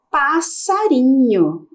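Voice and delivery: drawn out slowly for the class; slow reveal. Shown as a demonstration. Teacher revealing the word to the class, slow. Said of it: "A palavra é".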